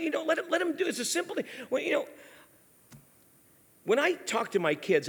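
normal talking voice

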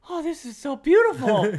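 high, loud gushing; mock delight. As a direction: gush like awestruck new parents. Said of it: "and we're like".

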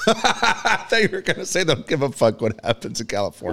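amused laughing. Real, not acted. Co-host cracking up at his partner's insult, laughing. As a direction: talk through a laugh, voice lifted.

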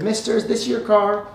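high-pitched Texas boy, lightly voiced; Texas accent. Boy asks man about his car, light Texas accent. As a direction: lightly put on a young Texan's accent. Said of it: "in a Texas accent, the boy said".

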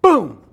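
loud booming cannon sound. Shown as a demonstration. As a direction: shout boom like a cannon. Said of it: "I want you to go".

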